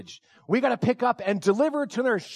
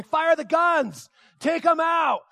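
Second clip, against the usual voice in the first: high, drawn-out battle yell; yelling orders; 6 semitones higher, 4 dB louder, slower than usual. Retold or imitated. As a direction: act out yelling at your gunners. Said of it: "I'm yelling like that".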